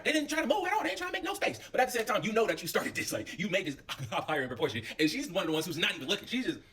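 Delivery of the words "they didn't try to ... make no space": high, petty complaining voice; mock indignant girl. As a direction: imitate Courtney griping. Voicing Courtney's complaint.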